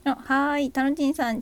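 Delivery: ordinary delivery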